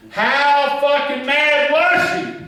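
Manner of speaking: high, loud, drawn-out call; slow hollered call-out to a crowd; rousing crowd shout. Real, not acted. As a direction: whip the audience up, slowly hollered. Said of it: "we're gonna get up some energy now".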